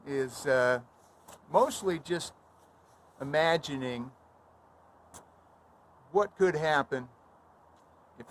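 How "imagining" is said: usual speaking voice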